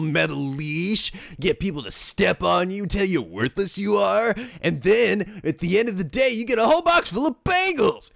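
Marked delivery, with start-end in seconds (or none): none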